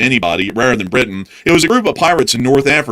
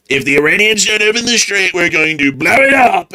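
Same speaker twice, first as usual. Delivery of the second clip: higher, slow mocking tough-guy threat; 8 semitones higher than usual. Mocking the US government's threat to Iran, higher pompous voice. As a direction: ham up a big empty threat.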